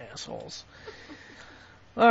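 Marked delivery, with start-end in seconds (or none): 0.1-0.6 voiceless muttered insult; whispered curse. Real, not acted. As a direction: hiss it under your breath. Cursing the company privately.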